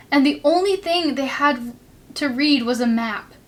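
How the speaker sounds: ordinary delivery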